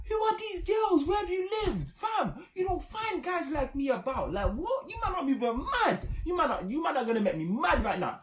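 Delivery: high, shouting mock outrage at the girls; mock outraged shout. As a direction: shout it high like you can't believe the insult. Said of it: "I was thinking".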